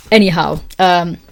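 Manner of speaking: normal talking voice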